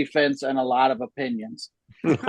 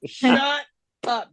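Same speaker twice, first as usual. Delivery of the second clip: high, drawn-out neighbor's yell; yelling at dog; 11 semitones higher, much slower than usual. Retold or imitated. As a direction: holler it over the fence at barking dogs. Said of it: "when you yell at a dog".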